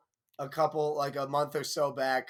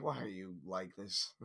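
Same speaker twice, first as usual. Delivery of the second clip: much lower and softer, tired father; mock father, low and quiet; weary dad; 8 semitones lower, 11 dB quieter than usual. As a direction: sigh it out like a worn-out dad. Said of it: "your dad's just like".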